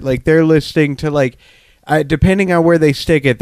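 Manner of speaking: ordinary talk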